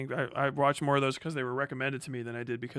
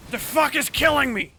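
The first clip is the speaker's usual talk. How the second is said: shout; 13 semitones higher, 10 dB louder than usual. Real, not acted. Sudden pain mid stream.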